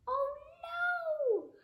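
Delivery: high, loud cartoon oh no; dismayed groan. Principal groaning at his awful new name. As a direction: wail it in comic despair. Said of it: "groaned the principal".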